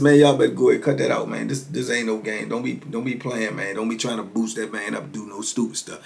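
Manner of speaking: low, soft telling-off; retold low-key scolding; subdued warning. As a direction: warn them off quietly, voice dropped. Barber tells onlookers to quit.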